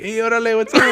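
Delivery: high, friendly street greeting; casual alien greeting. Retold or imitated. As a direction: greet the neighborhood cheerfully. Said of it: "walking around like".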